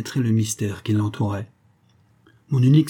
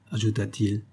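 usual speaking voice

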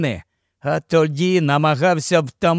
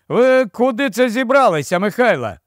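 high-pitched, moderately raised call; calling after; 7 semitones higher than usual. A narrator's reading. Captain calls after departing old Mykhailo, calling out. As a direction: call after someone walking off. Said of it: "гукнув йому вслід капітан".

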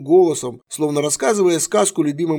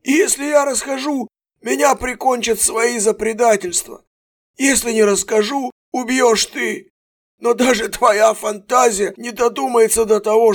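desperate fear; 8 semitones higher, 3 dB louder than usual. A narrator's reading. Prisoner desperate.

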